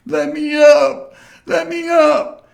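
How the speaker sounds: very high, loud, drawn-out wail; hammed-up crying bully begging for release; slow mock-sobbing shriek. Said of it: "that he was crying".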